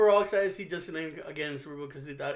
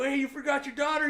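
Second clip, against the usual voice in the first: higher, teasing phone call; 8 semitones higher than usual. Retold or imitated. Telling brother he forgot daughter, teasing. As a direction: needle him on the phone.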